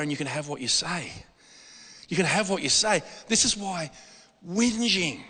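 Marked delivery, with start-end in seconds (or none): 0.0-1.1 quiet quote. Retold or imitated. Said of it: "says the other way".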